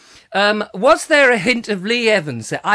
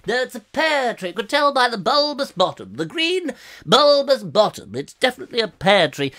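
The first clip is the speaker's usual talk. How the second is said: know-it-all reporter voice; 5 semitones higher than usual. Retold or imitated.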